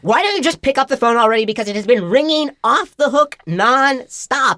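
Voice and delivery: high, loud, hammed-up ringtone rant; fever-pitch exasperation. Phone ranting at the stranger who won't pick up, frantic irritation. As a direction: rush in high, then punch out each word. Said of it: "the phone reaches this fever pitch".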